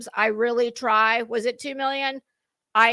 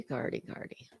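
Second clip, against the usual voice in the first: whispered name; 11 semitones lower, 16 dB quieter than usual. Real, not acted. Searching for [name]'s photo, whispered murmur. Calling to herself while scrolling.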